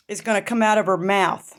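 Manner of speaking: usual speaking voice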